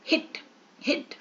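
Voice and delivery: "हिट" as one short beat; short, separate "हिट"; one syllable. Shown as a demonstration. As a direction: say "हिट" in a single stroke, pause, repeat. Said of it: "एक बार बोल रहे हैं".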